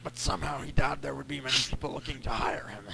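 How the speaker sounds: rough, raspy whisper. Acted. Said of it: "raspy voice".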